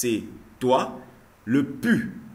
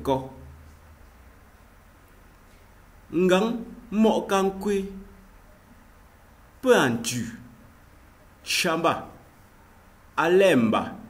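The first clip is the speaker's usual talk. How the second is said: slow, clear reading of each word; pronounced like a teacher's drill; careful language demo; 6 semitones higher than usual. Shown as a demonstration. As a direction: model each greeting for the class. Reads Ngemba greetings aloud.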